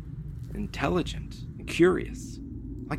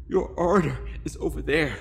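a higher, trembling plea to a mad captor; shaky pleading; 7 semitones higher, faster than usual. A narrator's reading. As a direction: beg for your life, voice wobbling. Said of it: "my shaky voice pleaded".

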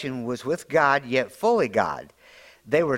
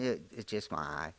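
softer, lower, curt reply; grumpy mumble; 8 semitones lower, 13 dB quieter than usual. Retold or imitated. Pastor retells muttering to Nancy about his eye. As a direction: brush her off quietly. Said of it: "And I went".

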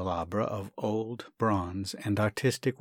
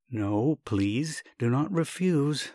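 ordinary delivery

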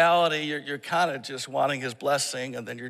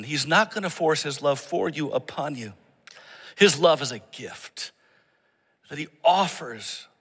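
normal talking voice

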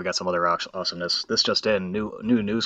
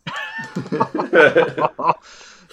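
big burst of laughter, pitched up; loud high laugh; 13 semitones higher, 9 dB louder than usual. Real, not acted. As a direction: crack up loudly. Laughing at the clip.

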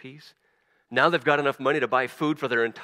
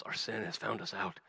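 guilty murmur; 5 semitones lower, 10 dB quieter than usual. Acted. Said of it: "these brothers keep saying to themselves".

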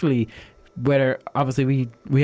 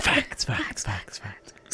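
whispered "facts" fading like an echo; homemade echo; 4 semitones lower, 8 dB quieter, much slower than usual. Pretend. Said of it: "Who needs, like, you know, echoing".

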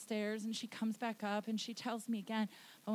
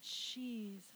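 hushed prayer; 2 semitones lower, 11 dB quieter than usual. Real, not acted. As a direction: breathe the name out quietly. Hushed prayer during ministry.

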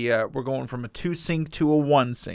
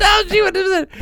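laughing squeal; 8 dB louder than usual. Real, not acted. Laughing at the joke.

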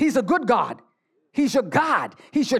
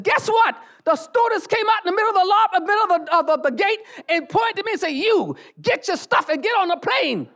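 excited news; 8 semitones higher than usual. Retold or imitated. Daughter telling her mother she got the seat, excited high voice. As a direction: burst out with the good news, pitched high.

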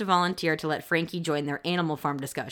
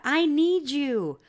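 dramatic pleading; 8 semitones higher than usual. Retold or imitated. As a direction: plead like a spoiled teen. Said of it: "Frankie texts Jonah".

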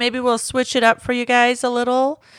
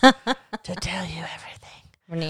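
whispering; 4 semitones lower, 5 dB quieter, slower than usual. Shown as a demonstration. Mock intro style, whispered.

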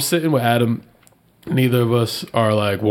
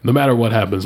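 normal talking voice

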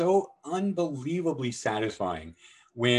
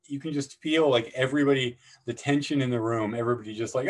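ordinary talk, nothing marked

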